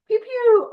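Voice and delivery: high, loud playful zap sounds; playful pew pew. Pretend. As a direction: fire off little laser noises. Playful sound effects to hand off.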